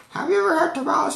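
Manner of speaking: put-on high, fast old lady voice; eager old lady quizzing him; high-pitched rapid old woman question.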